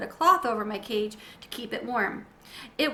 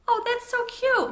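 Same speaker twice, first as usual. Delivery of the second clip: much higher, slow, louder coo; cooing mom; 13 semitones higher, 5 dB louder than usual. Acted. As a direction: gush over the tiny hamster. Mother cooing at hamster.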